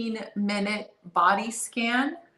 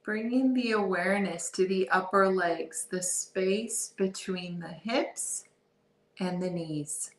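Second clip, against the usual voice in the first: calm, softer body-scan voice with long pauses; guided meditation; 3 dB quieter than usual. Real, not acted. Guide leading listeners through a body scan, guided meditation. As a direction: ease them into awareness, unhurried and soft.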